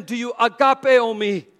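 high, loud, insistent question; emphatic questioning. Acted. Jesus asking Simon for sacrificial love, emphatic. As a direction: press the question with preaching force.

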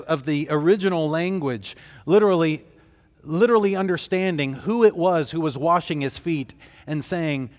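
usual speaking voice

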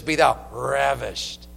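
RAVISHED drawn out slowly and low; relished RAVISHED. Shown as a demonstration. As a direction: savor RAVISHED with a long opening R. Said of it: "that's how you pronounce it".

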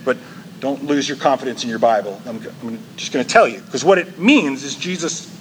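ordinary delivery

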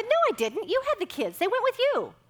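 high, loud, laughing insistence; laughing protest. Retold or imitated. Wife insisting to husband he had the kids, laughing.

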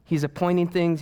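ordinary talk

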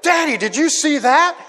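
put-on high, excited child's question; imitating amazed kids. Kids to Daddy after Mom's swan dive, amazed. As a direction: mimic a kid thrilled by what just happened.

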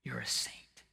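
soft unvoiced whisper. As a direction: drop to a whisper. Whispered after loud preaching.

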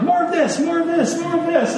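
high, urgent coach chant; hyped coach. Retold or imitated. Pastor mimics coach rallying players, high and urgent. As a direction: pump the team up like Klopp. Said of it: "Klopp is like".

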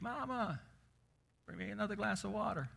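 put-on high, soft, quick child's whine; a little girl wheedling from bed; whiny little girl.

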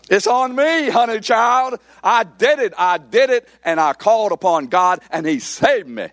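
high-pitched, hammed-up self-congratulation; mock boastful believer. Preacher mimicking a believer who claims the credit, mock boastful. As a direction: crow it way up high, mocking the boast.

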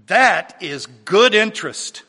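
ringing retold declaration of victory; triumphant cry. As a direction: proclaim it in triumph, as a preacher quoting. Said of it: "He cried out triumphantly".

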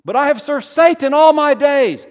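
shouted confession, high and very loud; desperate, loud protest of a sinner; fearful sinner's outburst. Acted. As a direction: cry it out at full force. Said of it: "the fears of the saints".

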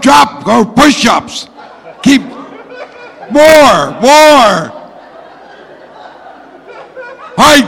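loud, high barked orders; drill sergeant shouting. Pretend. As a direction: yell commands like a drill instructor. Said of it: "What would a drill sergeant say to them".

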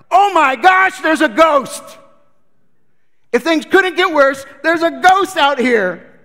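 high, loud shriek of panic; hammed-up terrified fishermen yelling; panicked disciples. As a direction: scream it like you've seen a ghost. Disciples panic seeing ghost.

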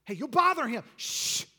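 high, loud scolding and a shush; rebuking hush. Acted. Disciples hushing the blind man, scolding. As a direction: scold him, then shush him. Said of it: "they which went before rebuked him".

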